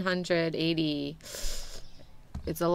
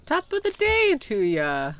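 hammed-up Irish greeting, high and loud; attempted British that slips into an Irish lilt; Irish accent; 6 semitones higher, 5 dB louder than usual. Shopkeeper hailing an English customer, accidental Irish accent. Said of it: "Did I just do an Irish accent?".

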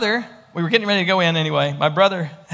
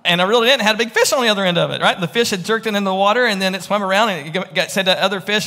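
ordinary talk, nothing marked

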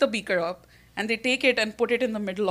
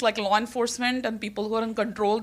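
ordinary delivery

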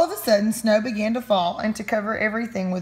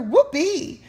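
joyful whoop; 7 semitones higher than usual. Acted. Katy cheers at new friend.

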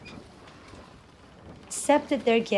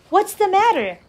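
put-on high-pitched boy's question; inquiring boy; 9 semitones higher, 4 dB louder than usual. A narrator's reading. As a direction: ask as a curious boy, pitched up. Boy questions the sad brothers.

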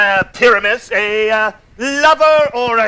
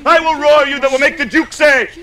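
usual speaking voice

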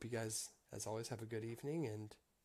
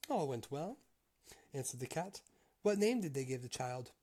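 higher, louder read-aloud storytelling voice; story narration; 4 semitones higher, 4 dB louder than usual. A narrator's reading. Reader telling a Grimm tale to listeners, story narration. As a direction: read it out clearly as a fairy tale.

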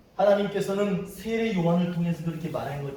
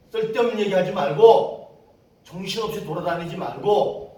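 loud, raised-pitch telling-off; stern scolding; 4 semitones higher, 9 dB louder than usual. Retold or imitated. Preacher sending missionaries back home, stern scolding. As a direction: scold them forcefully.

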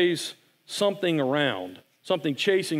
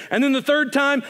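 ordinary delivery

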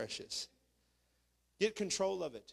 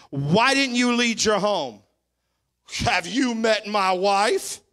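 Christ's stern question; 3 semitones higher, 10 dB louder, slower than usual. Retold or imitated. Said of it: "he's gonna look at them and say".

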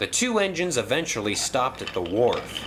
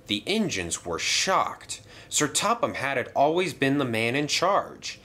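ordinary delivery

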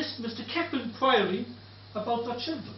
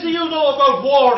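loud heckling challenge; retold shout; 10 dB louder than usual. Heckler challenges the Irish storyteller, loud. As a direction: bark it up from the crowd. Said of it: "the German shouted up to me".

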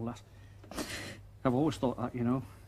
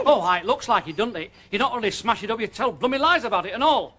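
angry; 6 semitones higher, 8 dB louder than usual. Acted. Husband rails at lies.

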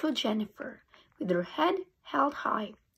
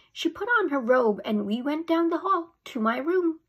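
ordinary delivery, nothing marked

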